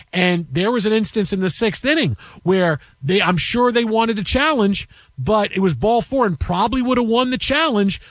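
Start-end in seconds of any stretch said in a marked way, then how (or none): none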